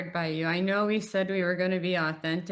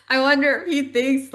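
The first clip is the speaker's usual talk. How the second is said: laughing; 7 semitones higher, 8 dB louder than usual. Real, not acted. Laughing while retelling massage thought.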